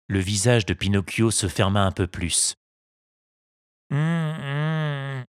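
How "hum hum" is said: reluctant grumbling hum. A narrator's reading. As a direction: grumble under his breath. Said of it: "Qu'est-ce que tu ronchonnes". (Pinocchio ronchonne)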